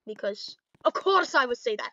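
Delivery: ordinary delivery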